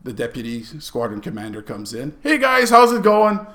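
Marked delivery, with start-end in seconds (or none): 2.2-3.4 high, loud, cheery hello; acted breezy greeting. Deputy squadron commander comes in, breezily cheerful. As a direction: play the easygoing boss arriving.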